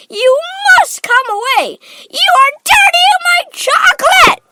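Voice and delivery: very high, frantic shout; Mister Wonka. A narrator's reading. Factory owner protecting his chocolate, frantically crying out. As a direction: build to a frantic, loud squeal. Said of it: "cried Mister Wonka, hopping up and down".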